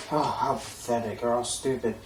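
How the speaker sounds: low, hushed aloof muttering; acting cool. Reviewer imitating Tokoyami acting all cool, aloof and hushed. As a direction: play it too cool, low and quiet. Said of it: "he's acting all cool".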